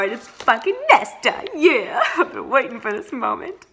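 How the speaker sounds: laughing with delight; gleeful, can't hold the laugh in; excited laughing. Real, not acted. Nesta arrives, long awaited.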